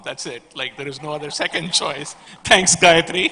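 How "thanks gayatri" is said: calling out thanks, much louder and higher. Real, not acted. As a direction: shout thanks across the stage. Calls out thanks across stage.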